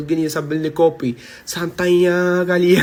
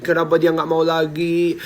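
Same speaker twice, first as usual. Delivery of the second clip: ordinary delivery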